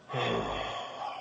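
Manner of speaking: long exhaled sigh; resigned sigh; weary sigh. Retold or imitated. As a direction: let out a heavy wordless breath. Sitting there, acting out reaction.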